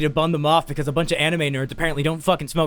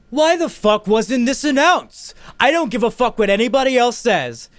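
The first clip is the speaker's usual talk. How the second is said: indignant complaint; 7 semitones higher, 3 dB louder than usual. Retold or imitated. Retold complaint at con staff.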